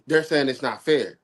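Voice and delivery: ordinary talk, nothing marked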